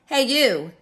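high, loud challenging call; pushy storybook bully hailing someone; grouchy ladybug. Acted. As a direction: call out to pick a fight. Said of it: "said the grouchy ladybug".

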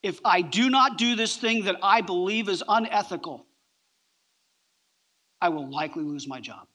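usual speaking voice

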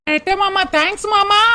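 triumphant thanks. Acted. Wife thanks husband, triumphant.

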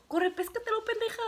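a high, pushy motherly order; mock mother urging. Mother telling her daughter to grab him, mock urging. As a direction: hurry her on, teasingly bossy.

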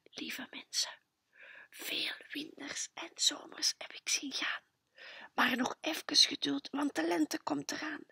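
soft voiceless whisper to sleeping parents. A narrator's reading. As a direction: whisper so nobody wakes. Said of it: "Tom de Tummetot zegt (whispering words)". (fluisteren, heel zacht)

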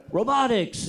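lightly voiced old scholar saying one word; elderly professor voice. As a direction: imitate an imagined stooped old expert. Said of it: "bent over and would be like".

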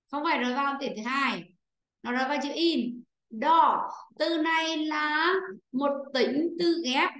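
usual speaking voice

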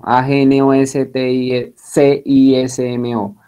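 A G N O S T I C I S M O said as separate letters, a bit slow; spelled out letters. Shown as a demonstration. Teacher spelling agnosticismo again so students can copy, slow. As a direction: run through the letters steadily. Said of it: "se los voy a deletrear".

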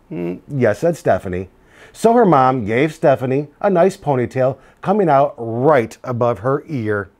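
lively picture-book read-aloud; reading to children. A narrator's reading. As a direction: tell it to a class of kids.

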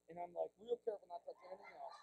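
tiny hushed aside, quick; barely audible, rapid murmur; very careful. Retold or imitated. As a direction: drop to almost nothing, as if tiptoeing. Acting out very careful touching.

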